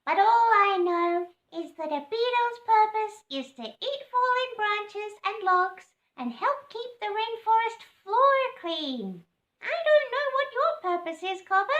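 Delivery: high, bright-eyed bug lecturing a cassowary; beetle voice. Acted. Longhorn beetle explaining its job to Cobber, high voice. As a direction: pitch it up high like a tiny beetle.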